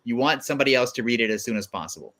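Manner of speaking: ordinary talk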